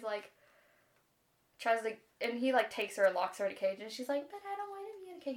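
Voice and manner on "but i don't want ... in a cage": high, soft, hurried mimicked whine; mock whiny protest. Princess protests being caged, mock whiny. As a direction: imitate a complaining princess, pitched up and rushed.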